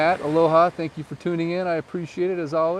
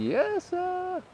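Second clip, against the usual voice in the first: squeaky yes sir; 3 dB quieter than usual. Acted. Answering a viewer that the gazebo's open, squeaky high. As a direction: chirp it like a cartoon.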